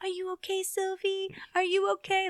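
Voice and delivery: put-on high-pitched little-child voice; toddler impression. Mom imitates toddler talking through her tantrum, tiny high voice. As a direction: pipe it up like a two-year-old asking after herself. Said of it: "And then she's like".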